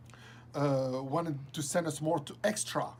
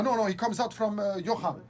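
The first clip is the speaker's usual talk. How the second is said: ordinary talk, nothing marked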